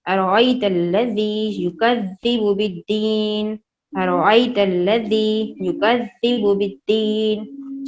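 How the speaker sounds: slow, even recital to repeat; slowly, for repetition. Shown as a demonstration. Teacher reciting slowly for children to repeat along. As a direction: recite slowly so they can follow. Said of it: "मेरे साथ एक दो दफ़ा इसे दोहराएं, आहिस्ता आहिस्ता".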